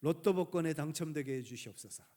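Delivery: 크게 "하나님"을 부르며 시작하는 기도; 기도하는 집사. Acted. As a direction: act out a deacon's loud, petitioning prayer. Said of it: "하나님 앞에 기도합니다". (하나님께 큰 소리로 간구하듯)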